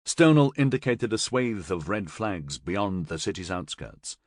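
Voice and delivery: usual speaking voice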